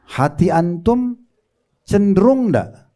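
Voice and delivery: pertanyaan pelan. Retold or imitated. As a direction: draw out each word as you probe. Menanyai jemaah soal hasil istikharah. (pertanyaan pelan dengan nada naik)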